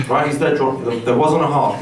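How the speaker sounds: ordinary talk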